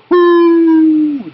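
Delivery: high, loud hooting owl call. Pretend. Father reading to his child, hooting like an owl. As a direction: hoot like a screech owl. Imitating owl's call in story.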